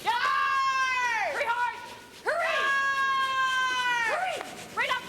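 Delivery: loud high sweeping calls; curling skip yelling. Real, not acted. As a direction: yell at the sweepers down the ice. Skip calling sweepers on ice.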